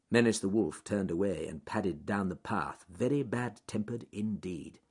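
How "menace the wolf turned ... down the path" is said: usual speaking voice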